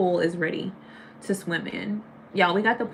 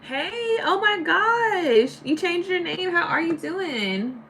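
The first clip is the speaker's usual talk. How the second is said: excited greeting; 9 semitones higher, slower than usual. Real, not acted. Chat friend returns after long time.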